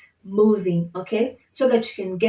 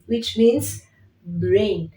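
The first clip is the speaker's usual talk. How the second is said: ordinary delivery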